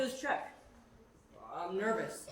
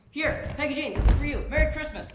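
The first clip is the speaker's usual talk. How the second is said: louder, flustered nervous rush; nervous blurt; 4 semitones higher, 8 dB louder than usual. Shown as a demonstration. Boy hands his valentine to the girl, flustered and loud. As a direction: blurt it out and get it wrong. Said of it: "Just don't get nervous".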